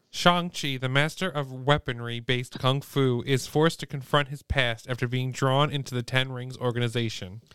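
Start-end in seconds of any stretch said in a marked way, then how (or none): none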